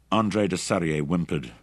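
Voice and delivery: usual speaking voice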